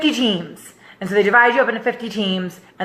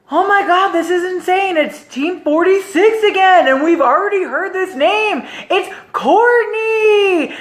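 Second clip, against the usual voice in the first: high, building to a long-held name; imitated excited announcer; 9 semitones higher than usual. Host drawing the big prize, excited announcer call. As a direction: hype up the big reveal in a high voice.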